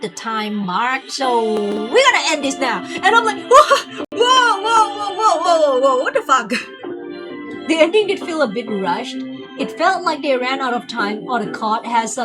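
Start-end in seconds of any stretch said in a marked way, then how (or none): none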